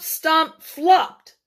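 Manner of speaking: usual speaking voice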